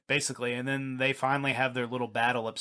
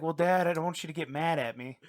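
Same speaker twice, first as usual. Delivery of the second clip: son to dad; 5 semitones higher than usual. Retold or imitated. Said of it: "He's like, he's like".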